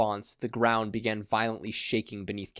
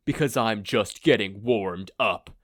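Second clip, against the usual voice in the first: slow, louder growling threat; slow menacing villain voice; 5 dB louder than usual. A narrator's reading. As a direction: lean into the menace, unhurried.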